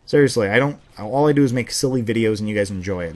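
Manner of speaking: ordinary delivery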